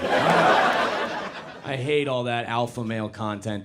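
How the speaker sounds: normal talking voice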